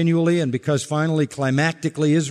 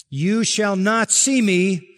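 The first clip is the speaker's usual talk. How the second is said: "You shall not see me" drawn out slowly at a higher pitch; raised, weighty pronouncement of "You shall not see me"; emphatic; 5 semitones higher, much slower than usual. Retold or imitated. Preacher quoting the Lord's warning, "You shall not see me" slow and emphatic. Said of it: "And it's emphatic".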